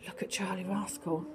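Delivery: hushed, amazed; gasped whisper. Acted. Storyteller gasps and whispers to child. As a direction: gasp then soft whisper.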